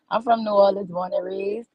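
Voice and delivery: ordinary talk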